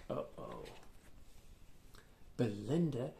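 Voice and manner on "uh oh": hushed warning whisper; whispered suspense. A narrator's reading. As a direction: breathe it like trouble is coming. Suspense before the kick.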